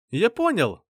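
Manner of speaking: high-pitched eureka cry; excited burst, pitch leaping up; exclaiming. A narrator's reading. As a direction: exclaim with sudden realization. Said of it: "воскликнул Лин".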